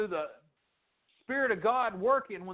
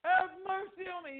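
high, stretched-out holler; imitated high calling out; 10 semitones higher than usual. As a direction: holler it high like a beggar calling after someone. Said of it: "He just keeps hollering it".

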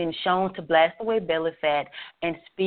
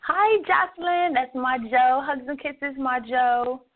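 much higher, gushing hello; excited greeting; 8 semitones higher than usual. Real, not acted. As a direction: greet a dear friend with delight. Greeting a friend who joined.

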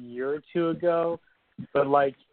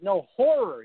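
HORROR higher and punched; emphatic correction; 6 semitones higher, 4 dB louder, slower than usual. Retold or imitated. Podcaster imagining the guest correcting the man, emphatic correction. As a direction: correct them firmly on HORROR. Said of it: "and you're like".

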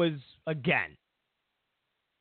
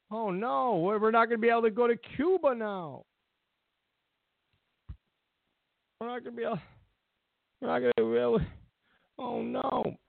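higher, whiny mock panic; 6 semitones higher, faster than usual. Mocking travelers panicking over the Cuba trips, whiny. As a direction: whine it like a panicking fan. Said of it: "Everybody, they're all freaking out".

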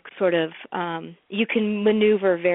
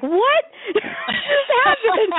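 slow, very high, loud cry of disbelief broken by laughter; laughing disbelief; 5 dB louder, much slower than usual. Retold or imitated. Scene: friend reacts to her chatting up the producer, laughing disbelief. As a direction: shout the friend's shock while laughing. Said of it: "And my friend's like".

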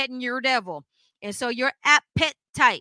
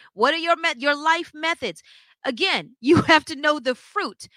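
usual speaking voice

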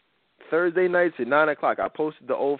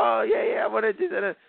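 eager caller; 8 semitones higher than usual. Retold or imitated. Host retelling old callers to his co-host, imitating an eager caller. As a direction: play an excited caller signing up on the spot. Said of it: "people calling from all over the world".

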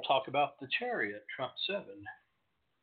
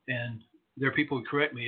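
normal talking voice

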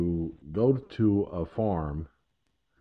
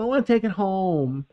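put-on high pleading child, a bit less high; little girl voice, repeated; 9 semitones higher than usual. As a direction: beg again like a little kid. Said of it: "or she'll be like".

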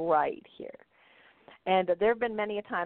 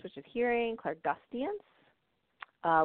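ordinary talk

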